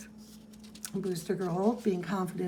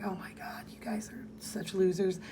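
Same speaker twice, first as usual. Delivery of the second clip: whisper; 5 dB quieter than usual. Retold or imitated. Canary dismisses the guys.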